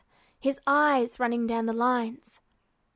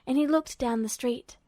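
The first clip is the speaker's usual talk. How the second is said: usual speaking voice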